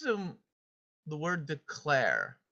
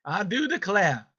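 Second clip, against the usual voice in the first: imitated Southern drawl, raised and slow; put-on Southern "declare", R dropped; Southern accent; 5 semitones higher, 5 dB louder than usual. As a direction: mimic a Southern speaker, louder and higher. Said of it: "listen to your own voice".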